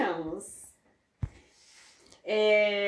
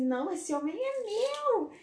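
high, drawn-out mock claim of a man; possessive claim; 10 semitones higher, slower than usual. Podcaster acting out the song's woman for co-host, mock possessive. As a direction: play her staking her claim, pitched up and slow. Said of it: "ela fala assim".

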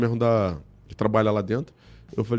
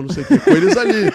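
laughing; 10 semitones higher, 10 dB louder than usual. Real, not acted. Telling a funny story.

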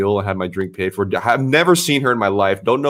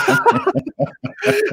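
high laughing burst at the friend's offer. Real, not acted. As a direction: crack up at the bodyguard joke.

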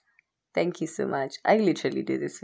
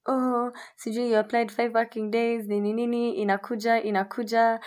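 customer service voice; 7 semitones higher than usual. Retold or imitated. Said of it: "they're like".

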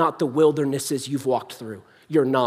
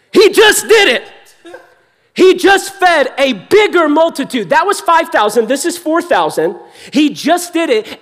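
higher, emphatic preacher's outburst; incredulous exclamation; 10 semitones higher, 7 dB louder than usual. Real, not acted. Preacher marvels that disciples forgot the miracle, incredulous exclamation. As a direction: exclaim it in disbelief, starting loud.

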